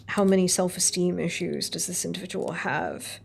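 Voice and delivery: usual speaking voice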